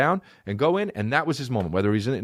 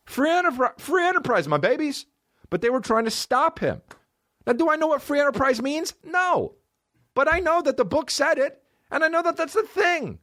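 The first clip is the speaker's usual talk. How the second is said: hyped rant; 13 semitones higher than usual. Real, not acted.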